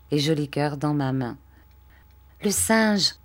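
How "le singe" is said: put-on higher, mildly excited child's cry; exclaiming. A narrator's reading. Arthur greeting the monkey, exclaiming. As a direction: cry out with a touch of delight. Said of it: "s'écria Arthur".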